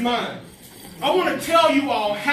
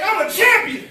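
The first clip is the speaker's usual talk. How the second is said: higher, punchy rallying call; from the chest; 6 semitones higher than usual. Shown as a demonstration. Speaker leading students in affirmations, from the chest. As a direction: say it like you mean it, chest out. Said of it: "hit it with your chest".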